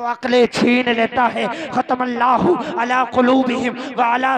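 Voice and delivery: ringing recitation into a triumphant announcement; slow and stretch the final punchline; full-force preaching. Acted. (पूरे जोश में तक़रीर)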